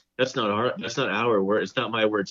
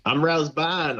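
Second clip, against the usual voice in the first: badly faked Australian, pitched up; bad Australian accent; 6 semitones higher, slower than usual. Imitating an Australian actress for the co-host, bad Australian accent. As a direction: attempt an Australian accent and miss. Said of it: "I can't even do the accent".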